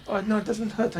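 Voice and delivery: normal talking voice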